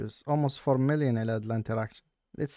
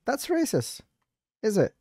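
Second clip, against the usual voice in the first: mocking the officer; 9 semitones higher, much slower than usual. Mocking the cop's accusation for viewers, squeaky. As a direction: parody the scold.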